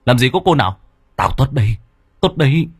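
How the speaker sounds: loud, rough shout to wake him; shouting at his dazed friend. Acted. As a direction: bark at him to snap out of it. Tuất shouts at dazed Mạnh.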